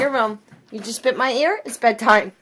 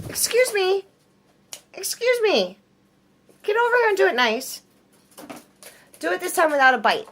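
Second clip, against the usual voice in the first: mock scolding; 7 semitones higher than usual. Real, not acted. Scolding the bird for biting.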